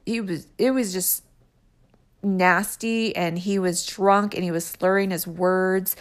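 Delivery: ordinary talk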